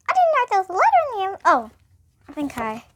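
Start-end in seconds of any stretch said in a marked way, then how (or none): 0.1-1.4 high, fast mouse squeak; high squeaky mouse blurting. Acted. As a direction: gabble it in a tiny mouse voice. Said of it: "squeaked Mouse".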